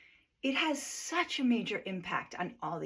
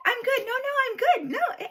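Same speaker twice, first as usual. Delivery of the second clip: very high, loud, breath-held insistence; mock-anxious high-pitched reassurance; talking high; 8 dB louder than usual. Anxious people insisting they are fine, talking high. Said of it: "we talk fast and we talk high".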